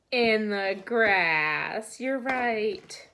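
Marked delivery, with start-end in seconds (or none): 0.1-2.0 "In the grass" drawn out slowly and loud; slow affirmation. Shown as a demonstration. Teacher confirms the horse lives in the grass, slow and loud. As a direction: stretch "In the grass" slowly and clearly.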